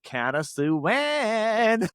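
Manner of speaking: drawn-out, very slow high starstruck greeting; starstruck gasp of a name. Retold or imitated. Fan meeting Countess Luann, saying her name slowly in awe. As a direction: stretch her name out, dazzled. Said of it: "I just went".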